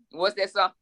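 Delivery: usual speaking voice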